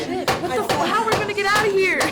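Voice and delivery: high, frantic outburst; mimicked panic. Child mimicking trapped adults saying bad words, high panic. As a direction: blurt it high like someone trapped.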